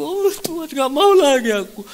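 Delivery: high, drawn wail to God; mock wailing prayer; acted-out despair. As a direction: moan it as a desperate, high-pitched plea. Acts out his inner despairing prayer.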